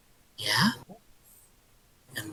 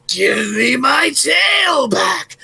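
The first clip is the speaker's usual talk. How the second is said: higher, louder, slow drawn-out roar; growling monster; 8 semitones higher, 5 dB louder, much slower than usual. Acted. Beast face to face with the old man, slow roar. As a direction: roar it right in his face. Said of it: "it growls".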